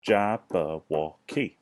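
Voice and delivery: "Jabberwocky" very slow, in four separate beats; syllable by syllable. Shown as a demonstration. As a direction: sound out "Jabberwocky" loudly, pausing between syllables. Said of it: "Let's say it together".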